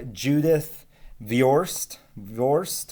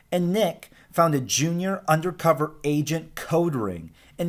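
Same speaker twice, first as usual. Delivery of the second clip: lively, higher storybook voice for the class; reading to children; 5 semitones higher than usual. Acted. Teacher reading Alexander to her class, lively storytelling voice. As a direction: read it like a picture book to kids. Teacher reads picture book aloud.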